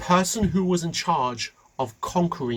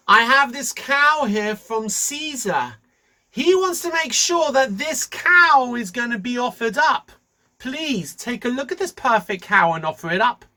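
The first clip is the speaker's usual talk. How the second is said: scheming messenger voice; 9 semitones higher, 7 dB louder than usual. Acted. Bar Kamsa presenting the cow to the priests, high and loud. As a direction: sell the cow to the priests with false sweetness.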